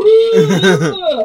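a high, laughing mock squeal; toddler-like squeal. Sheriff rolling in the back seat, high laughing squeal. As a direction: squeal like a toddler tumbling about.

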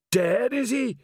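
high-pitched troll voice; troll's query, pitched well up; troll sergeant. A narrator's reading. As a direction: ask it as a blunt troll constable. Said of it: "said Detritus".

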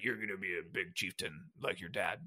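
a hint of a grave dead father; imitating Durotan's voiceover. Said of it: "He's like".

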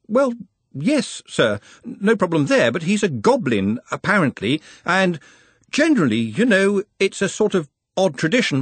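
the nervous clerk edging a question out; fretful junior, pitched well up; anxious young wizard. Acted. Ponder voiced in audiobook.

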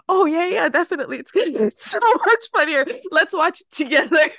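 eager friend voice. Retold or imitated. Friend luring her into watching the show, eager and loud. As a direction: sell it like a sly friend.

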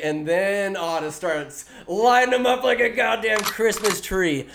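ordinary talk, nothing marked

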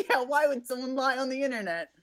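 high-pitched, laughing sarcasm; mock innocence. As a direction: echo it with pretend naive disbelief. Joking echo of partner's sarcasm.

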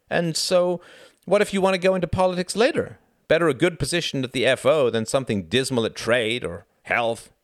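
high, hearty, insistent character voice; persuasive Frederick. A narrator's reading. Frederick urges Reginald toward the Foreign Office, high and persuasive.

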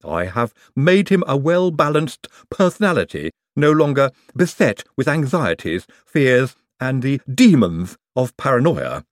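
put-on lisping voice of Igor. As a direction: lisp it earnestly as a doctor's henchman.

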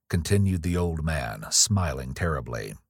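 usual speaking voice

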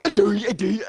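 be the weirdest person possible; acting bizarre so a monster leaves; weird creepy voice. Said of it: "and I'm just sitting here like".

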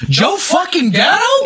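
high, drawn-out disbelief; incredulous outburst. Retold or imitated. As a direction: cry it out slowly in shrieking disbelief. Acts out disbelieving reaction.

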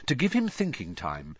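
ordinary delivery, nothing marked

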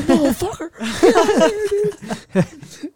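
high, laughing mock yell; hammed-up high-pitched yell; imitating the dad. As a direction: squeal it out like the dad gone wild. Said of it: "He's like".

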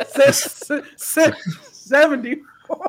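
stammered out through laughter; laughing. Real, not acted. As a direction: barely get it out between laughs. Laughing at the joke.

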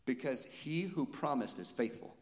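ordinary talk, nothing marked

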